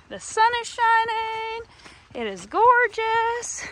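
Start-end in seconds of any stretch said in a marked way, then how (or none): none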